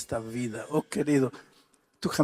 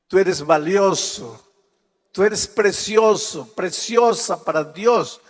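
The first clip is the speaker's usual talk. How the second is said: emphatic; 6 semitones higher, 7 dB louder than usual. Acted. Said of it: "rising emphatic declaration".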